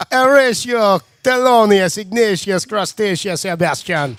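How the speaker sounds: slow, drawn-out Jamaican lilt; Jamaican accent. Retold or imitated. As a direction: say the full name in a Jamaican accent. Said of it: "Jamaican accent".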